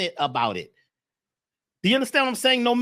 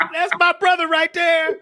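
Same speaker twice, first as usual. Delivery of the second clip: high, loud, proud shout-out; excited hail; 12 semitones higher, 6 dB louder than usual. Real, not acted. Hails his friend joining the show.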